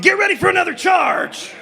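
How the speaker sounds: rallying shout. Acted. Outlaw leader orders his gang.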